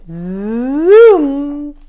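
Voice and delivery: long, loud rising zoom; excited zoom. A narrator's reading. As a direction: launch the bee off with a swoop. Bee zooms off.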